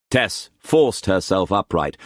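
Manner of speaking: usual speaking voice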